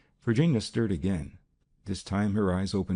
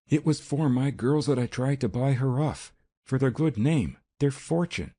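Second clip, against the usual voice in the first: imploring mother, pitched up; imploring cry; 6 semitones higher than usual. A narrator's reading. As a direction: beg for your daughters' sake. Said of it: "she cried imploringly".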